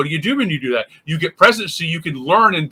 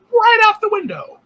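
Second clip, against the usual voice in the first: squeaky high flourish; 3 dB louder than usual. Acted. Teacher playing out time flying off, high squeak. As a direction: leap way up high on window.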